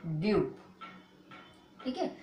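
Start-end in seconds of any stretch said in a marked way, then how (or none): 0.1-0.4 "ड्यू" with yu glide, falling low; one low syllable "ड्यू", silent final e; correct pronunciation. Shown as a demonstration. Teacher answers own drill question, correct pronunciation "ड्यू". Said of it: "क्या पढ़ेंगे".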